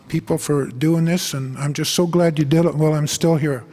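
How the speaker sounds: ordinary delivery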